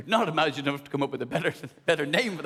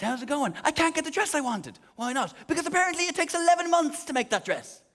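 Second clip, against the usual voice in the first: lightly voiced wife; 9 semitones higher than usual. Wife reporting dress news.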